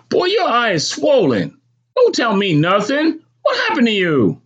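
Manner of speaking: very high, insistent scolding; scolding mother. Acted. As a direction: demand an answer from the boy, pitched high. Mother scolds and questions son.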